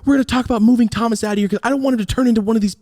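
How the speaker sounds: high, sing-song mock sob of mom; fake sobbing. Mom telling grandma about moving Thomas, fake sobbing. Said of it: "she's fake sobbing".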